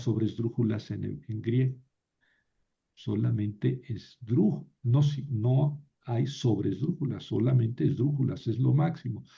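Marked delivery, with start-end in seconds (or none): none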